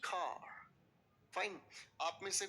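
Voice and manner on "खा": "खा" with a breathy kh; kha sound. Shown as a demonstration. Teacher showing students another way some say it, aspirated kha. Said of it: "कुछ लोग इसे बोलते हैं".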